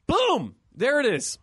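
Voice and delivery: sudden high burst on boom; storyteller's sudden boom; excited exclamation. Retold or imitated. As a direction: pop the boom way up. Exclaiming the boar reveal.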